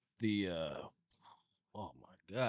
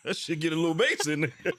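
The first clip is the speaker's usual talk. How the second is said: loud, high teasing imitation of deepening the voice; mock dad voice; 7 semitones higher, 9 dB louder than usual. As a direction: friend teasing the dad's bass, loud and laughing. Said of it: "you like".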